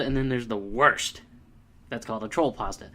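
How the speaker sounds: usual speaking voice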